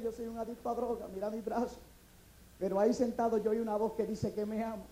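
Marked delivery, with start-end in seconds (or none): 0.0-1.8 soft, low admission; subdued confession. Acted. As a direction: drop the voice, confessing quietly. Tearful addict pleading with policeman.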